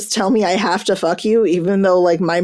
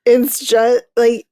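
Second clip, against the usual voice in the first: gushing; 5 semitones higher, much slower than usual. Real, not acted. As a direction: swoon over the couple. Gushing about the couple.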